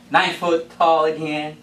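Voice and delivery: ordinary delivery, nothing marked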